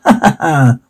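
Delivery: hammed-up ha-ha laugh; acted laugh. Narrator acts the crowd, mock laughing. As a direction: put on a jeering ha-ha. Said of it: "they laughed".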